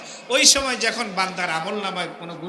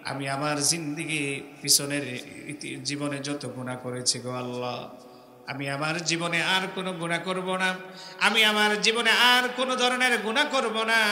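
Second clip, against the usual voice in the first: pleading prayer; 4 semitones lower than usual. Acted. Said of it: "মুখে উচ্চারণ করতে হবে, স্বীকৃতি দিতে হবে আল্লাহর কাছে". (নরম নিচু গলায় কাতর মোনাজাত)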